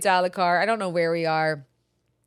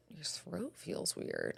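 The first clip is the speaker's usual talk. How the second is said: quiet puzzled question; 3 semitones lower, 17 dB quieter, much slower than usual. Retold or imitated.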